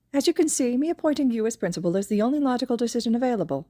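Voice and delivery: pleased little mastermind closing his trap; smug principal Nezu. A narrator's reading. Nezu's smug logical argument.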